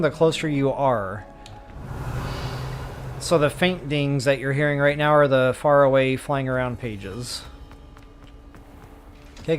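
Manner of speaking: ordinary talk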